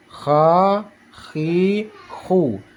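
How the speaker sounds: "Kha, khi, khu" with continuous throaty friction; flowing kha. Shown as a demonstration. Teacher showing flowing sound, "Kha, khi, khu" scraped. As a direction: let the rasped KH flow in "Kha, khi, khu".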